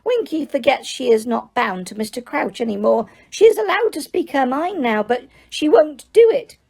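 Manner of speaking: high, squeaky elf voice, eager to please; squeaky house elf. Acted. House elf tells Harry he needs work, high and squeaky. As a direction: pitch it up as an excitable little elf. Dobby continues.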